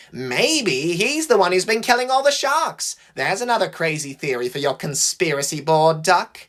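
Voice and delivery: high, jeering speculation; theatrical high mockery; mocking. A narrator's reading. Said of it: "his voice radiating mockery".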